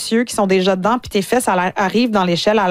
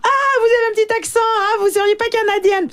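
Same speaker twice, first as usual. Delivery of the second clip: high, fast mocking European French accent; 13 semitones higher than usual. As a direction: mimic a European putting on a prissy French accent. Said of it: "quelqu'un pour imiter mon accent".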